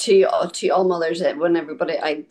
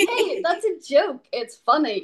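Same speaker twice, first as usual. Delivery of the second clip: playful reassurance; 9 semitones higher than usual. Retold or imitated. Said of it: "And I'm like".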